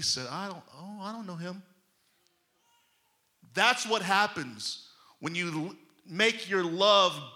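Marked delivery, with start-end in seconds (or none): 0.3-1.6 very soft, sheepish disowning; embarrassed denial. Retold or imitated. Peter denying Jesus to a slave girl, quietly embarrassed. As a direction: shrink back and deny it quietly. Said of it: "the minute he got embarrassed".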